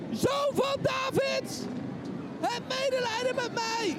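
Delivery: high, slow pleading cry; desperate shout again. Acted. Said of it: "hij roept nog een keer". (nog een keer smekend roepen)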